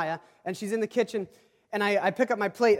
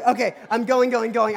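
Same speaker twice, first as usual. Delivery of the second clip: hurried and loud, pushing myself out the door; mock frantic going going; loud hurried rush; 3 semitones higher, 6 dB louder than usual.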